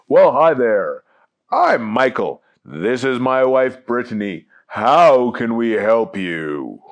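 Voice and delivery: Michael. Acted. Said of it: "Out came a man and a woman".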